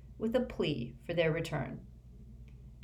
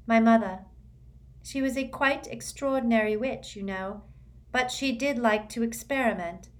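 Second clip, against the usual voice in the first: higher voice recounting loss without fuss; Luna, matter-of-fact; 5 semitones higher, 3 dB louder than usual. A narrator's reading. Luna telling Harry about her mother's death, higher and calm. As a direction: say it simply, almost lightly.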